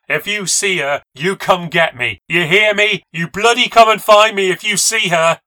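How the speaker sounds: loud, high desperate yell; crying out. Acted. Rose yells after the fleeing narrator, desperate. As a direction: call after her down the road. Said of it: "I heard her cry out to me".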